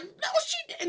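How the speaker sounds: squeaky high "no she didn't", mock disbelief; shocked coworker. Retelling the coworker's look at the child's remark, in high mock disbelief. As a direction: voice the other teacher's scandalized look. Coworker's reaction voiced in story.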